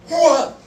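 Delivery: short high exclamation of effort; high lunging "hwah"; effortful lunge noise. Retold or imitated. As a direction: mimic a lunging grab with a sudden high sound. Imitates astronaut's lunging grab.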